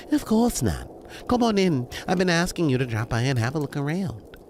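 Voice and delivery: high, reassuring hostess voice; welcoming, insistent hostess. Acted. Jasmine welcomes the timid visitor in.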